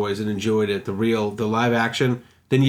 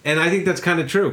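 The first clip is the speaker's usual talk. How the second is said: ordinary delivery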